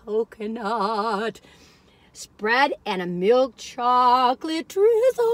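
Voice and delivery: slow breathy whisper. Real, not acted. Describing the cookie to viewers, slow whisper. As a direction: savour the words in a hushed whisper.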